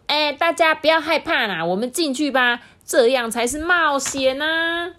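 put-on high, bright-eyed kid voice urging the others on; a brave little boy egging friends in; Xiaogua, eager child. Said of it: "小瓜就说".

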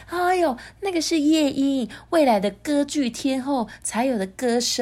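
high doting brag; gushing queen. Acted. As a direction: praise the voice like a proud mother. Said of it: "皇后就说".

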